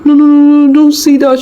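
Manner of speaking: husband comforting crying wife; distressed pleading. A narrator's reading. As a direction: beg her to stop blaming herself.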